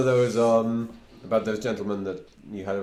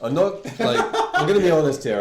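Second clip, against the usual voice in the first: laughing; 7 semitones higher, 7 dB louder than usual. Real, not acted. Laughing in character.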